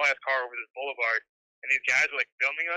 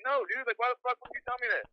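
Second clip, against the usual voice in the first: own retort; 9 semitones higher, 5 dB quieter than usual. Retold or imitated. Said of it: "I was like".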